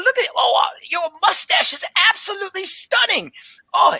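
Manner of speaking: very high, breathless delight; excited tabaxi druid. Acted. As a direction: gush like a wide-eyed scholar. Tabaxi druid marvels at Nigel.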